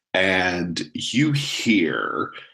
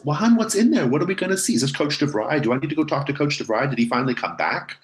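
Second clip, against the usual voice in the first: worried mom; 10 semitones higher, faster than usual. Acted. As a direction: fire off worried questions as the mom.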